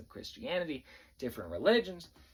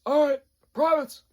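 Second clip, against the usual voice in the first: loud, high parade-ground shout; drill sergeant voice; 12 dB louder than usual. Retold or imitated. Drill sergeant addressing privates, loud shouting voice. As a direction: bark it like every drill sergeant. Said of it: "they all talk like this".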